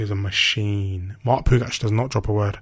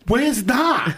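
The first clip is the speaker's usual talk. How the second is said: much higher, mock-incredulous question; baffled mum; 13 semitones higher, 4 dB louder than usual. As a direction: imitate a mum squawking in disbelief. Mum's baffled question, retold.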